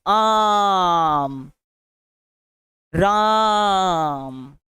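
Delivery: "राम" held extremely long and slow; long drawn call. Shown as a demonstration. Teacher shows prolated vowels by calling Ram, long and slow. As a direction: stretch "आम, राम" into a far-reaching call. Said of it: "ऐसे करके".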